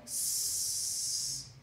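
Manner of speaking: a long, breathy unvoiced hiss; a deflating balloon; soft hiss of escaping air. Shown as a demonstration. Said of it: "cuando se desinfla un globo".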